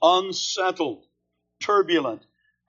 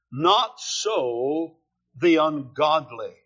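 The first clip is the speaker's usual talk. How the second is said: slow literal reading; slower than usual. Shown as a demonstration.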